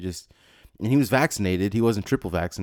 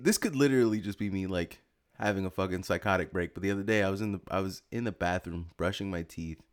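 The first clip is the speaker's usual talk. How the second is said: ordinary delivery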